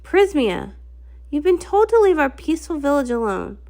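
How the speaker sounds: usual speaking voice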